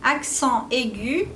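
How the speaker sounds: ordinary delivery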